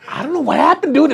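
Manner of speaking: high-pitched retold bewilderment; out of character. Telling how he dropped character with his friend, bewildered. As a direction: jump up high, thrown off balance. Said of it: "went out of character, totally like".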